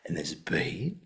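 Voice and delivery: soft Australian delivery with BEAT rising at the end; imitated tutor emphasising "beat" with a questioning lift; Andrew Davidson. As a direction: stretch "beat" upward, quietly, like the tutor. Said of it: "he was always emphasized that".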